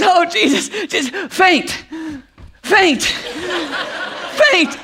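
high, comically desperate urging; hammed-up exhausted pleading; self-commanding daughter. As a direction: mimic a kid ordering herself to pass out. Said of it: "trying to get her own body to faint on command".

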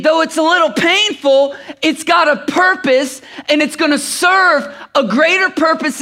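fervent preacher building on repeated phrases; impassioned preaching. Real, not acted. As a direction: press the lesson home to a congregation. Preaches the lesson of the story.

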